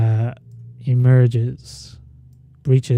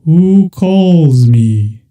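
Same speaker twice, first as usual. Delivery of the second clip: giant whale voice; 4 semitones higher, 7 dB louder, much slower than usual. Acted. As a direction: boom it out slowly, ancient and vast. Whale speaks to players.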